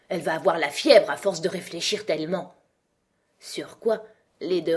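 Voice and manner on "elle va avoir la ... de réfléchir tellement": plain reading voice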